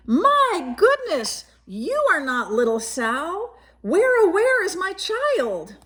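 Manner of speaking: high, gasping mock alarm; gasping alarmed mother. Mother finds a bear instead of her child, high gasping alarm. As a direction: act startled as a mother who lost her child.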